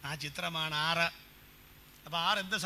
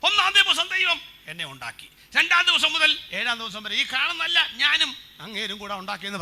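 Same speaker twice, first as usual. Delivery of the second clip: loud, much higher mock boast; boastful Adam; 6 semitones higher, 12 dB louder than usual. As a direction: brag like a show-off claiming credit. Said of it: "അവൻ പറയത്തില്ലയോ". (പൊങ്ങച്ചക്കാരന്റെ ഉച്ചത്തിലുള്ള പരിഹാസ വീമ്പ്)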